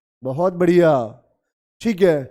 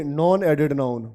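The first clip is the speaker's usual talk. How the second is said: slow answer; 4 semitones lower, slower than usual. Shown as a demonstration. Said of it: "तो आप इसको क्या बोलोगे?".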